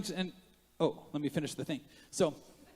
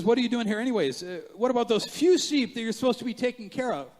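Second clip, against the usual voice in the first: raised, loud scornful questioning; angry older brother; 9 semitones higher, 6 dB louder than usual. Acted. Eldest brother challenging David about his sheep, acted anger. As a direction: scold the little brother, high and loud. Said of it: "he was angry".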